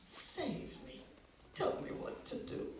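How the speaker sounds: breathless whispered begging; whispered plea. Acted. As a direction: fade to a desperate whisper. Moishe ends plea.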